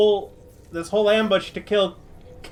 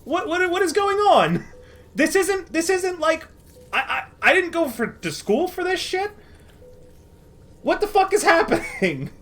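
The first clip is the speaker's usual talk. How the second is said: higher, softer, flustered rambling; bewildered, high; 6 semitones higher, 3 dB quieter than usual. Acted. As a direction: fall apart in confusion. Gunman bewildered, losing it.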